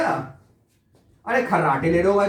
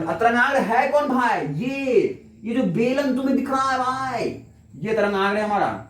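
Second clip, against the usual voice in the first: higher-pitched, insistent explaining; emphatic insistence; 6 semitones higher than usual. Real, not acted. Teacher pointing out the wavefront to students, emphatic insistence. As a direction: hammer the point home, voice raised in pitch.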